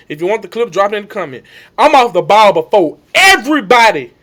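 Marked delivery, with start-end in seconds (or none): 1.8-4.1 loud re-enacted brag; emphatic boast. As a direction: boast it out to the field.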